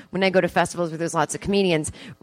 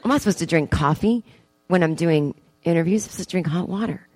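usual speaking voice

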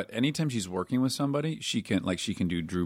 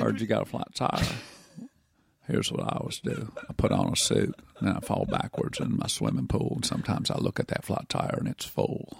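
dreamy, laid-back movie-star musing; Matthew McConaughey impression. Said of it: "my McConaughey impersonation".

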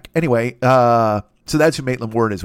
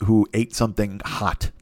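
normal talking voice

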